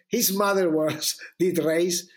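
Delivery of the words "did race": usual speaking voice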